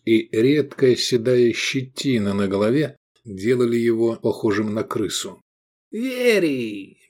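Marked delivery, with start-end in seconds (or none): 5.9-7.0 high, sugary squeak; squeaky. A narrator's reading. Little rat-like man greeting his friend, squeaking. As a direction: squeal the name with a syrupy smile. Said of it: "пропищал мужчинка, слащаво улыбаясь".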